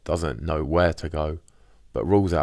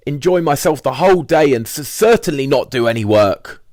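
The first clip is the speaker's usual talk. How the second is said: higher, louder, stammering over and over; Richard's voice; 9 semitones higher, 8 dB louder than usual. Retold or imitated. Richard telling the squat he's done working, stammering higher and louder. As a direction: trip over the words, then blurt it out. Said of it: "Richard declare for the first time ever".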